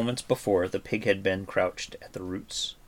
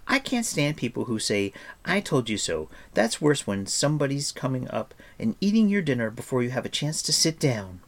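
put-on higher-pitched, indignant girl character; complaining, pitched up character voice; chattering Eilonwy; 6 semitones higher, 4 dB louder than usual. A narrator's reading. Narrator voices Eilonwy in a story.

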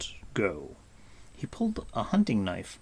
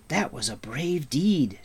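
higher, mildly eager exclamation; excited cry; 5 semitones higher than usual. A narrator's reading. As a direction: admire the deed with some eagerness. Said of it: "Taran cried".

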